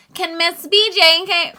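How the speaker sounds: high, slow announcer voice; lightly voiced office announcer. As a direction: page her like a school office.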